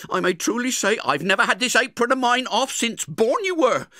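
high, loud furious scold; scolding sister. Acted. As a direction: tear into the boy for being out. Angry sister scolds Pip.

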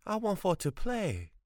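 put-on high pleading boy's wail with stress on want; piteous wail. A narrator's reading. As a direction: beg to play, wailing. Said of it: "he wailed piteously".

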